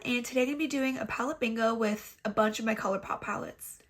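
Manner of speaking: normal talking voice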